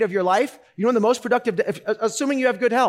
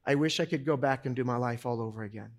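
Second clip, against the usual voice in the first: quiet regretful quote; 6 semitones lower, 6 dB quieter than usual. Retold or imitated. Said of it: "this is what he said".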